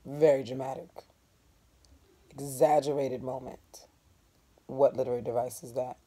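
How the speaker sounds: ordinary delivery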